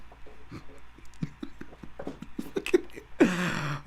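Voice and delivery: long airy laughing fit; helpless unvoiced laughter; breathy real laugh. Real, not acted. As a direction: crack up, trying to stay quiet. Laughs after loud impression.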